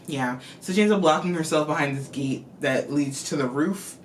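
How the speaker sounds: ordinary talk, nothing marked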